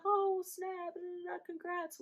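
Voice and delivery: high-pitched light congratulations; imitated surprised brother. Brother seeing picture on FaceTime, high excited imitation. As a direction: exclaim high, then skim through it.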